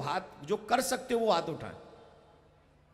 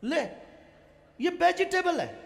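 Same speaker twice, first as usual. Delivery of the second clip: mock anger; 3 semitones higher, slower than usual. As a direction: scold the fool in jest. Mocking someone claiming egg is vegetable. (अंडे वाले पर नकली गुस्से में डाँट)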